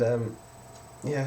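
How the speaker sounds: ordinary talk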